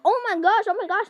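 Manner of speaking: loud, excited oh my gosh; excited gasping. Retold or imitated. Classmates reacting to the story, loud and excited. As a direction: everyone gushing at the big reveal. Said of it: "everyone was like".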